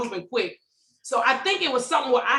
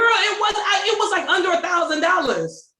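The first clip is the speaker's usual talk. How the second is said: indignant outburst; 7 semitones higher, 4 dB louder than usual. Real, not acted. Indignant outburst about small debt.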